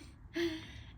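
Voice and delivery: short high giggle; a quick amused giggle; light giggle. Real, not acted. Giggles about baby being nice.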